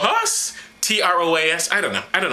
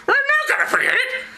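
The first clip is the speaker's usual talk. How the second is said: high, indignant whiny apostle; high Paul voice. Acted. Paul snaps back at Barnabas, high and offended.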